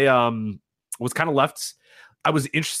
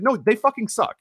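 mock blunt retort; 12 semitones higher than usual. Imagined retort to critics.

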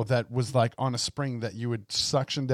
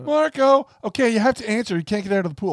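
higher, louder call across a pool; 9 semitones higher, 4 dB louder, faster than usual. Retold or imitated. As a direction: shout it across the water. Girl calling in pool game.

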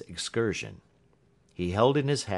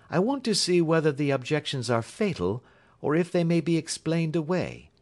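higher, emphatic detective voice; Holmes, brisk and decided; 7 semitones higher than usual. Acted. Holmes tells Watson they are going to Stoke Moran, brisk and decided. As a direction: press on firmly, pitched up. Holmes answers, then exclaims.

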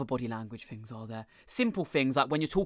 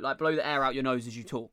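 ordinary delivery

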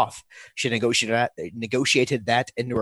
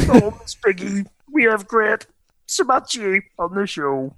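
high-pitched puppet frog impression; raised, put-on frog voice; Kermit the Frog; 5 semitones higher than usual. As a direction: do a goofy frog puppet hosting a show. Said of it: "pretend like you're Kermit the Frog".

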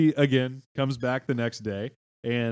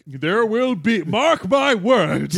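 proclaiming; 7 semitones higher, 9 dB louder, much slower than usual. Pretend. Mock prophetic declaration.

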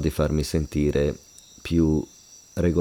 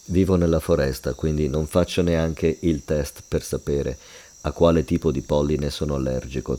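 ordinary delivery

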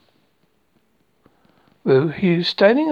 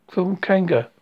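plain reading voice

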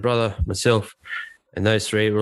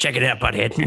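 cartoon teen voice; 2 semitones higher than usual. Shown as a demonstration. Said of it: "I can do a few".

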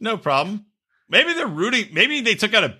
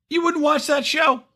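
incredulous; 12 semitones higher than usual. Real, not acted. Pressing friends about show idea.

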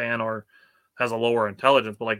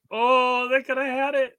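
mock dismay; 13 semitones higher, 3 dB louder, slower than usual. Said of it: "I'm like".

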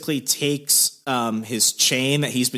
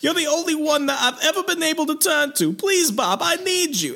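high-pitched, hammed-up pleading young man; John Travolta. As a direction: plead melodramatically at a bedside in a put-on voice. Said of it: "continue doing this voice throughout the show".

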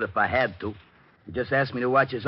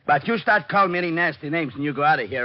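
louder and higher, threatening to throw him out; loud threat; 7 semitones higher, 4 dB louder than usual. Acted. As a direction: raise the voice, warn him hard.

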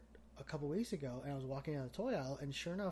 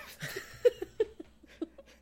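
unvoiced squeal of laughter; helpless high-pitched laugh; 5 dB louder than usual. Real, not acted. Laughing fit mid-announcement.

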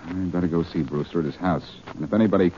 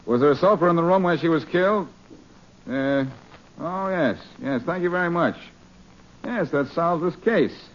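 higher, put-on gruff police voice on the phone; brusque impostor cop, pitched up; posing as Faraday; 8 semitones higher than usual. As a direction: pass yourself off as the inspector. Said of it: "This is Inspector Faraday of the police".

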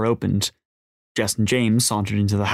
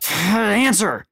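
high, strained yell; desperate scream; 11 semitones higher than usual. A narrator's reading. Jess screaming into a ringing phone, desperate yell. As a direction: cry out in frantic impatience. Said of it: "Jess screamed into the ringing phone".

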